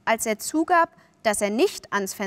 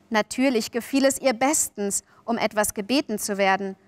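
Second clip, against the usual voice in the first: ordinary delivery, nothing marked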